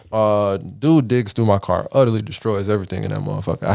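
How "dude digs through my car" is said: ordinary delivery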